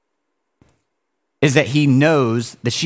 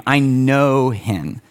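ordinary talk, nothing marked

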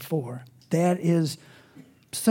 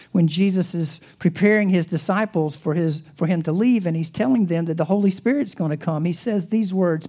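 ordinary delivery

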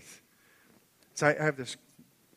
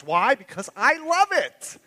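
much higher and louder, bursting with delight; excited outburst; 12 semitones higher, 16 dB louder, slower than usual. Acted. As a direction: shout your love for it to the room. Preacher's fervent outburst.

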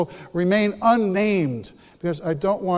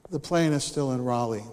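deadpan low reply; 7 semitones lower, 7 dB quieter than usual. Retold or imitated. Gate agent's flat reply.

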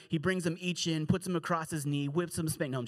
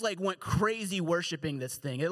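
ordinary delivery

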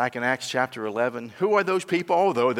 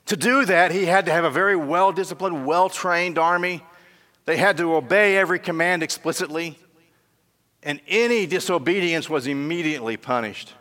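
higher, louder preaching; fervent sermon delivery; preaching at full force; 6 semitones higher, 6 dB louder than usual. Acted. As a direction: drive the story home from the pulpit. Preacher building up story.

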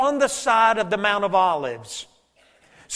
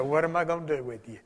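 fond exasperation; 6 semitones lower, 9 dB quieter, faster than usual. Retold or imitated. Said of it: "you just go".

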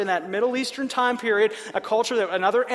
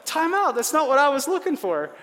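mock shocked protest; 5 semitones higher than usual. Habakkuk's shocked objection, voiced.

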